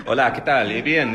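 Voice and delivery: loud mock-Spanish delivery; pretend Spanish. Pretends to speak Spanish at the Oscars, mock Spanish. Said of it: "I just pretend to".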